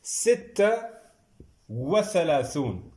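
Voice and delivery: teacher calling the next number; called out number. Shown as a demonstration. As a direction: announce the number, then dictate slowly. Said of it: "یہ ہو گیا thirty six".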